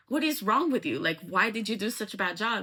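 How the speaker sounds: acted indignant questioning; angry, retold. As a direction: demand an explanation, retelling his anger. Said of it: "he's super angry".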